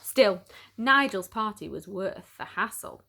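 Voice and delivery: ordinary delivery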